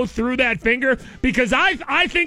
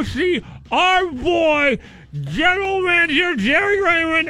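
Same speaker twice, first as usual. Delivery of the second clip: slow, higher hype ad read; 4 semitones higher, slower than usual. Acted. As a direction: sell it like a car dealer ad. Live ad read.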